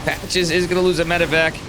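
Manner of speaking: high-pitched, excited game commentary; thrilled caster, pitch jumping up; excited play-by-play. Real, not acted. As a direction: call the clutch play with real excitement. Live commentary on a surprise play.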